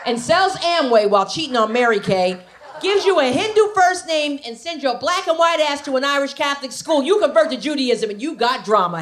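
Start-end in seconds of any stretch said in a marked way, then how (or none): none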